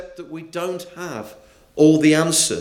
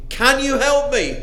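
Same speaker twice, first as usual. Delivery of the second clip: pleading; 9 semitones higher than usual. A narrator's reading. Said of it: "The tourist pleaded".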